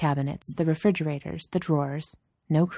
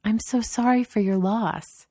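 higher-pitched, exaggerated condolence; mock sympathy; 6 semitones higher than usual. Boss consoling a man over his Starbucks, mock sympathy. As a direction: console him with mock sadness. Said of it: "She tilts her head sadly".

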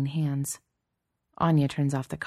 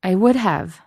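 louder, higher, emphatic insistence; voice bubbling out; 4 semitones higher, 8 dB louder than usual. A narrator's reading. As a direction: let the protest bubble out loudly. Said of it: "her voice bubbling out".

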